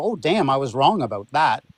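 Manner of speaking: ordinary delivery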